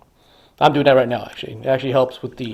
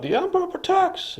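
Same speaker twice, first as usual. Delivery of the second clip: mock battle scream; 6 dB quieter than usual. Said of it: "he screams out".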